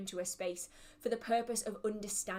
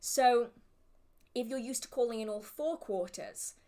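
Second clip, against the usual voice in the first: ordinary talk, nothing marked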